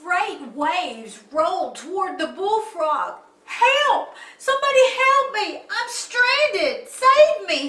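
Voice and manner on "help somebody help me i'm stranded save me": high, loud cries for help; shouting. A narrator's reading. Stranded bullfrog shouting over the waves, desperate. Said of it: "he shouted".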